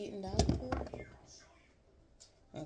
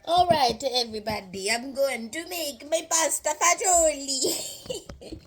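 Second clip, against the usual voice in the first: loud, hammed-up Italian accent; 3 semitones higher, 6 dB louder than usual. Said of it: "Forgive my Italian accent".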